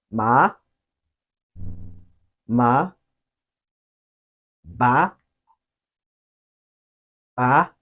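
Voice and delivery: MA, BA, YE, LAO each gliding upward; questioning rise on MA, BA, YE, LAO; second tone. Shown as a demonstration. Teacher gives examples of the rising tone. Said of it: "also be called the rising tone".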